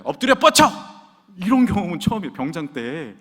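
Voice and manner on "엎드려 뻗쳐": high, loud officer's command; acted drill-officer shout; barked military order. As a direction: order him down for punishment.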